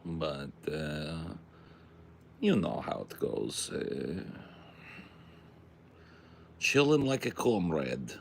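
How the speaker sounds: a hint of a Russian comrade's accent; mild mock Russian accent. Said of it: "Is my Russian accent so good".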